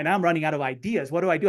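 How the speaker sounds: normal talking voice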